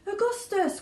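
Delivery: high anxious mother calling out. A narrator's reading. Mother calling her greedy son from the river. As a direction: call him like a fretful mother. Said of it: "called out Missus Gloop".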